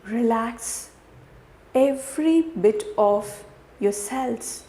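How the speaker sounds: guided meditation. Real, not acted. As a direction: guide the listener gently through each breath.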